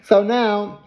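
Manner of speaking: ordinary delivery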